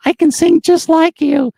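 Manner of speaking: very high, loud, cracking nervous squeak. Retold or imitated. Young fan boasting to [name], voice cracking high. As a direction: mimic a nervous kid whose voice shoots up and cracks. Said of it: "And my voice is cracking".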